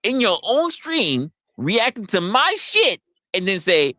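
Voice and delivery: high, stretched-out grievance; mock indignant complaint. Streamer scolding reactors in their own stream, high drawn-out protest.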